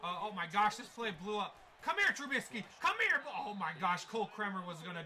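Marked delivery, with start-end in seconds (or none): 1.8-3.2 loud, rising chase yell; urgent calling. Real, not acted. Gamer chasing quarterback Trubisky, urgent yell. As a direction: holler after someone getting away.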